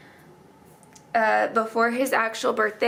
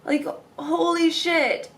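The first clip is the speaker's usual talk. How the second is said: shocked exclamation; 6 semitones higher, slower than usual. Real, not acted. Shocked at date.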